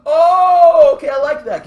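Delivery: high, loud burst of delight; excited. Real, not acted. Game master loves the player's idea, loud and high. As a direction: jump on the idea with glee.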